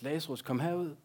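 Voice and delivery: calls out loud and high; Jesus calling into the grave, loud. A narrator's reading. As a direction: call the dead man out of the tomb. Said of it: "råber han med høj røst".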